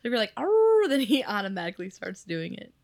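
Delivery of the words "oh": high, loud howl; mock howl. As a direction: howl to get the dog going. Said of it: "if you howl".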